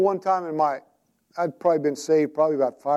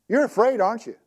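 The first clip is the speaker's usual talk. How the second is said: higher, teasing challenge; teasing wife; 6 semitones higher than usual. Retold or imitated. Wife Sue teasing her husband, higher and teasing. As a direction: poke at him knowingly.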